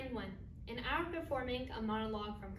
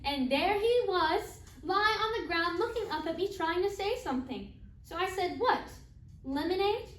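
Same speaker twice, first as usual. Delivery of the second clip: higher, lightly heightened monologue voice; raised, theatrical telling with questioning offers; acted storytelling; 4 semitones higher, 4 dB louder than usual. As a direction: tell it brightly, as if offering refreshments. Acted monologue, finding Zachary on ground.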